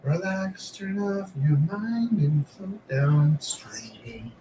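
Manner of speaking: slow, soft Liverpool drawl. Pretend. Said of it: "this is my [name]".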